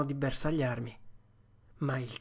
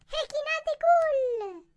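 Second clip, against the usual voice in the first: squeaky, very high-pitched little voice; high falsetto pipsqueak shout; tiny falsetto cry; 3 dB louder than usual. A narrator's reading. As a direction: cry out like a thumb-sized creature. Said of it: "gridò con una vocina in falsetto".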